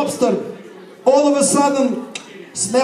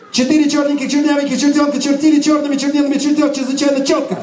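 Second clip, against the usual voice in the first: fast recitation; 3 semitones higher, much faster than usual. Shown as a demonstration. Teacher reciting fast for the audience to repeat. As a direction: rattle it off fast. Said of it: "tongue twister".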